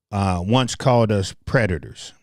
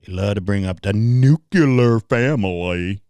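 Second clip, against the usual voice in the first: code-switching voice. Shown as a demonstration. Mocking those who bring up family, code-switching voice. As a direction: put on a mock-proper voice. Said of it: "I can go to this voice".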